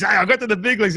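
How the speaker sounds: put-on high, loud brag to the kids; mock boasting. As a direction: play at proudly announcing your big moment. Imagined boast to his kids.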